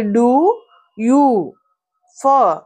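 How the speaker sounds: "do... you... for", each drawn out high; long vowels. Shown as a demonstration. Teacher showing the long vowel sound, drawn-out and separate. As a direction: stretch the vowels in "do", "you", "for", pausing between. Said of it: "long vowel వచ్చింది".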